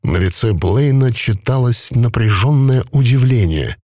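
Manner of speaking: plain reading voice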